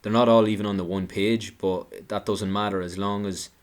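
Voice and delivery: normal talking voice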